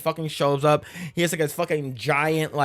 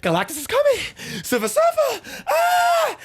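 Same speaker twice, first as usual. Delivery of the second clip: hammed-up panic, climbing to a much higher shout; panicked alarm; slower than usual. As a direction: scream the warning in mock terror, much higher.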